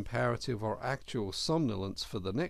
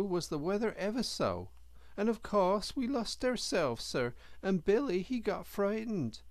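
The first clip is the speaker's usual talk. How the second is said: timid little hedgehog, higher pitched; higher, earnest country boy; young hedgehog voice; 9 semitones higher than usual. A narrator's reading. Young hedgehog tells his story.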